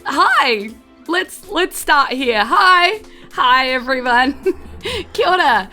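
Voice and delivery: usual speaking voice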